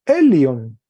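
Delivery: "el león" read loud and clear, falling; teacher sounding out Greek. Shown as a demonstration. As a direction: call out "el león" for the class. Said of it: "Vamos a repetirlo nuevamente".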